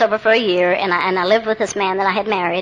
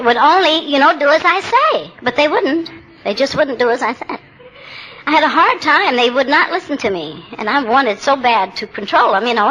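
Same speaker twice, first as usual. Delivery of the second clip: ordinary talk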